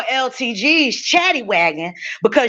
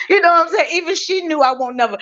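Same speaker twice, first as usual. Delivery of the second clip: ordinary talk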